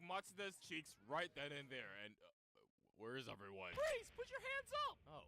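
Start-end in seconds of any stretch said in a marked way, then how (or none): 3.7-5.0 much higher, louder yelled order; high hammed-up shouted command; police shout. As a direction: bark it like a TV cop.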